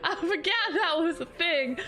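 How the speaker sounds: high, laughter-filled voice; laughing in shock. Real, not acted. Streamer forgot the alert existed, laughing in shock.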